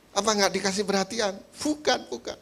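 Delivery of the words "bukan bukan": higher, a lightly voiced wife saying no; a hint of a fussy wife brushing it off; wife's denial. Wife waving off the pastor's guess, light high denial.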